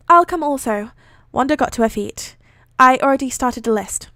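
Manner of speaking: projected, measured read-aloud; story narration. A narrator's reading. Reading a fan fiction aloud, measured narration. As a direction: read the story out clearly, voicing dialogue lightly.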